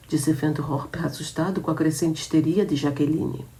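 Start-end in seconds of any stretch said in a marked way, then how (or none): none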